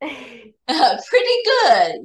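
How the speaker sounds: says it through a laugh, slowed right down. Real, not acted. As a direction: laugh while saying pretty good. Student laughs at pretty good.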